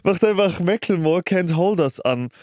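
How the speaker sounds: ordinary talk, nothing marked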